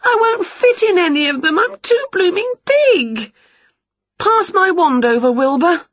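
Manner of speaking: high, worried witch Winnie; fretting witch. Acted. Winnie frets to Wilbur, high and flustered.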